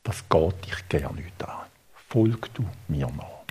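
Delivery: low, hushed, slow rebuff; understated stern reply, almost whispered; quoted brusque answer. Retold or imitated. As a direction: shut the question down quietly and slowly. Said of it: "eine ganz schroffe Antwort".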